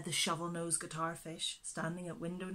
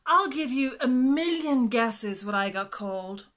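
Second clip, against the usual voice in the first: sulky, louder grumble; frowning; 5 semitones higher, 11 dB louder than usual. A narrator's reading. Fish grumbling to the others, a louder frown. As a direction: complain with a frown. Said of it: "frowned the fish".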